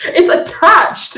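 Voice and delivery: high, drawn-out exclamation; exclaiming. Acted. Game master as a man tugging at a gift, exclaiming. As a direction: stretch it out with the voice pushed up. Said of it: "he's like".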